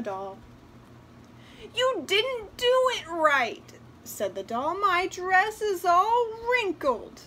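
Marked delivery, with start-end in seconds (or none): 1.7-3.7 high, loud, slow complaint; scolding doll. A narrator's reading. 4.7-7.2 high, loud, drawn-out whine; complaining doll. A narrator's reading. Doll complains about dress.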